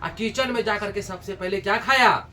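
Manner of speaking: ordinary talk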